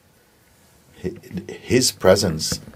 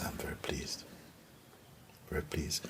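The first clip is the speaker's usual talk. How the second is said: softly hushed, pleased voice; God's quiet approval; 19 dB quieter than usual. Acted. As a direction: say it very softly, almost whispered. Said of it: "God says".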